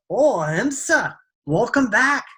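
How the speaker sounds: high, singsong delighted welcome; excited greeting. Acted. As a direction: greet a long-lost friend in a high lilting voice. Old man startles her with welcome.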